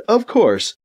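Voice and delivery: ordinary delivery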